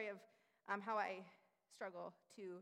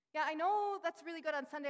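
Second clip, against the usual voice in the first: higher, louder, gushing "yeah, I know"; mock eager churchgoer; 6 semitones higher, 8 dB louder than usual. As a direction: parody someone nodding along too eagerly. Mocking churchgoer's easy agreement.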